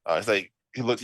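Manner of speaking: usual speaking voice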